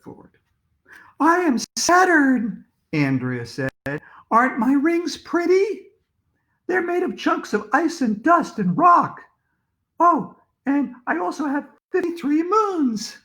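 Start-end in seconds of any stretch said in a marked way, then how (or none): 1.2-2.6 very high, loud and drawn out; high-pitched Andrea playing Saturn; high girlish character voice. A narrator's reading. Narrator voices Andrea in school play. 4.3-13.2 high-pitched Andrea playing Saturn; high girlish character voice. A narrator's reading. Narrator voices Andrea in school play.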